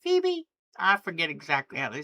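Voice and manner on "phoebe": "Phoebe" sung as a high bird call; birdsong imitation. Shown as a demonstration. Showing viewers the phoebe's call, high birdlike chirp. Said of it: "their sound is Phoebe".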